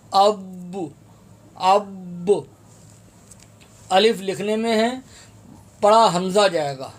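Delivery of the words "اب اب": اب ended with a bounced ب on the pause; qalqala on stop. Shown as a demonstration. Teacher showing a stop in recitation, bounced ب. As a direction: let the ب of اب echo at the stop. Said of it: "آگے وقف".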